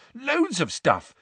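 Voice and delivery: high, slow and proud. A narrator's reading. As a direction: show off the plant, beaming. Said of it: "said Neville proudly".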